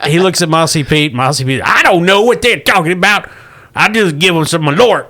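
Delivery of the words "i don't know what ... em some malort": shrugging backwoods pilot. Acted. GM voicing Mossy Pete.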